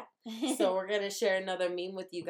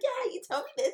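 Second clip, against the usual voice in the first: much higher-pitched, fed-up reminder; exasperated squeal; 13 semitones higher, faster than usual. Retold or imitated. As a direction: squeak it out like you've heard it all before. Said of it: "You'd be like".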